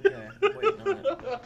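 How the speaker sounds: high laughing protest. Real, not acted. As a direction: crack up while saying no. Reacting to funny photo.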